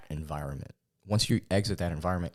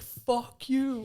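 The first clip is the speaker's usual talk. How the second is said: comedian snapping. Retold or imitated. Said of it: "he's like".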